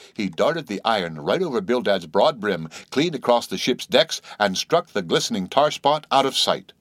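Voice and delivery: usual speaking voice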